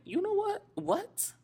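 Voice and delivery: very high-pitched, incredulous questioning; high squeak of mock astonishment; retold disbelief. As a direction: act out baffled disbelief, voice shooting way up. Retells disbelief at being called out.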